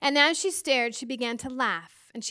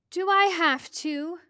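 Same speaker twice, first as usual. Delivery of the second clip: reluctant plea; 6 semitones higher, 5 dB louder than usual. A narrator's reading. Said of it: "the medium asked".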